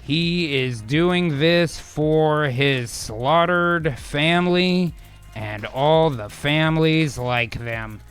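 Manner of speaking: measured storyteller pacing; dramatic scene setting. Acted. Game master's dramatic scene setting.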